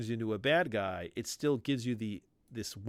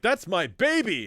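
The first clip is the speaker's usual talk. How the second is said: high, loud proud announcement; proud mother; 8 semitones higher, 8 dB louder than usual. Retold or imitated. Jubilee introducing her dragon child, loud proud mom.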